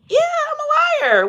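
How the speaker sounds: sing-song high taunt admitting the lie; mocking liar. Lying entity taunting the betrayer, high and smug.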